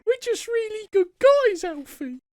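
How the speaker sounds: put-on squeaky, very high child voice, loud; hammed-up little boy pleading his case; Keanu Kid. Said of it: "do it in your Keanu Kid voice".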